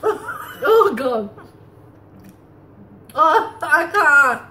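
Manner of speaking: puckered sour-face talking; laughing through mouthful; talking with candy in mouth. Real, not acted. Seen 0: Left woman grimaces, laughs, then fingers candy at her mouth.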